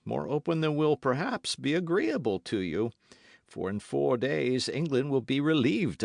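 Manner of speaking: lightly voiced sneering nobleman; a hint of a scornful captor; Baron's mocking speech. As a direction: taunt her with cold irony. Baron taunting his prisoner.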